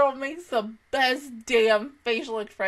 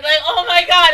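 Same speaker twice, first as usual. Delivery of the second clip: much louder, higher outcry; loud gush; 5 semitones higher, 12 dB louder than usual. Real, not acted. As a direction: blurt it out loud in delight. Overwhelmed fan outburst.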